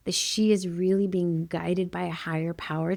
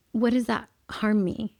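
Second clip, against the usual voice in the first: ordinary delivery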